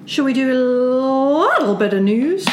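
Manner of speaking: high, slow singsong; singsong announcement. Acted. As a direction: sing it out like a jingle. Announcing news segment.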